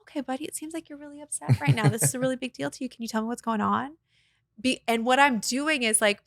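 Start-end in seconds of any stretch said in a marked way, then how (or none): 0.0-4.0 high, quick soothing voice; calm an upset child kindly; calm, gentle parent. Retold or imitated. Mom soothing her angry son in a calm voice. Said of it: "I go".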